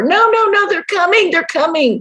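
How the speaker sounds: high, frantic no no no; panicked refusal. Retold or imitated. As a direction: refuse in terror, falling away at the end. Man refusing again.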